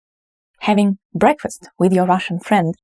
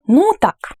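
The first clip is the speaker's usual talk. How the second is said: of course, obviously; 7 semitones higher than usual. Shown as a demonstration. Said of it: "you strongly agree with that".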